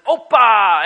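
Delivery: loud cheerful call of greeting; festive shout. Retold or imitated. One guest greeting the family gathering, shouting. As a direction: bellow it to the room like a party guest. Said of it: "someone says".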